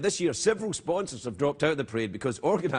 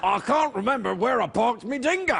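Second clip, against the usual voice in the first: mock Australian accent; 6 semitones higher than usual. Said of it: "You're thinking of Australia".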